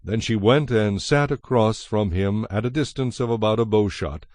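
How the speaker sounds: normal talking voice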